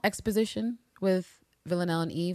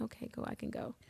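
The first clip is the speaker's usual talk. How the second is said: soft unvoiced whisper, much softer; whispered to herself; 5 semitones higher, 15 dB quieter than usual. Eve checking her hair before leaving, whispered to herself. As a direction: whisper it to yourself at the mirror.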